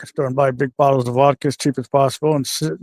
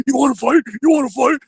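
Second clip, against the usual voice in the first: much higher, challenging retold taunt; a hammed-up brute picking a fight; mock threatening; 11 semitones higher than usual. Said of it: "He goes".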